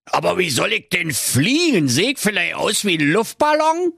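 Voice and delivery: put-on high, protesting old miser; dreading old grouch. A narrator's reading. Old miser told he must fly, alarmed. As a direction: object in alarmed disbelief. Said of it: "Knöterich erbebte vor Grausen".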